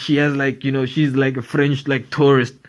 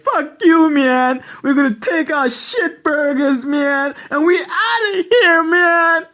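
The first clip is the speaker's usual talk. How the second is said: much higher, whiny mock voice; company voiced as a sulking guy, much higher; McDonald's as a person; 11 semitones higher, slower than usual. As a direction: storm off in a whining high voice. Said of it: "McDonald's was like".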